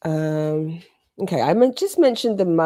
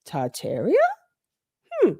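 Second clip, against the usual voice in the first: puzzled echo; 9 semitones higher than usual. Retold or imitated. Her brain reacting to a friend's tip, puzzled. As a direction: turn the word over as a puzzled question. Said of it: "And my brain went".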